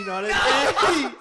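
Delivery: loud laughing correction. Real, not acted. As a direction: burst out laughing mid-sentence.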